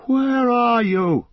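loud, high and slow lost call; slow miserable loud cry; crying out miserably. Acted. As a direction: call out alone and despairing. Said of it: "he cried out miserably".

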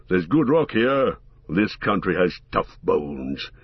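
low, heavy dwarf voice; gruff gravelly dwarf voice. Acted. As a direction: growl it out like a stout dwarf. Gimli the dwarf speaks.

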